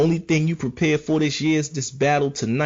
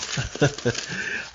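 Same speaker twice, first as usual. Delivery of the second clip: embarrassed laugh; 7 dB quieter than usual. Real, not acted. Laughing at his own flub.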